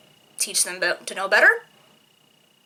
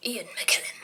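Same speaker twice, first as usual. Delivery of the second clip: hushed, breathy reverence; awed whisper; 8 dB quieter than usual. Real, not acted. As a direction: whisper the name like a holy word. Reverent name of the actor.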